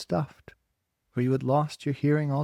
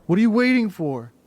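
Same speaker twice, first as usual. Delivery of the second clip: loud, high sneering taunt; taunting yell; 7 semitones higher, 8 dB louder than usual. A narrator's reading. Bully taunting Margot, loud and sneering. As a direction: jeer at her loudly.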